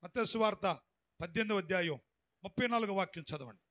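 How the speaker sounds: ordinary talk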